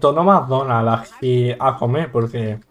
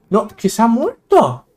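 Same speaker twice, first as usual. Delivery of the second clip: playful shock; 8 semitones higher, 3 dB louder, slower than usual. Pretend. Shocked at death in the game.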